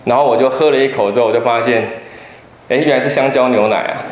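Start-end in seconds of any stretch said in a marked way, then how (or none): none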